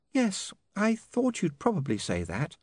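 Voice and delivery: high, slow, sad woman's voice. A narrator's reading. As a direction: say it slowly with high-pitched disappointment. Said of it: "she said sadly".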